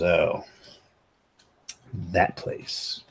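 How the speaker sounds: low, quiet, weary barman; heavy sighing. Acted. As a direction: say it on a heavy sigh, softly. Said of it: "lets out a heavy sigh".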